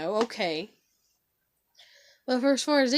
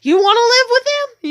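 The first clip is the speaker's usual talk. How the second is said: high, loud incredulous question; shocked disbelief; 7 semitones higher, 11 dB louder than usual. Retold or imitated. Asking her daughter, in loud shocked disbelief. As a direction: retell the shock, pitch soaring.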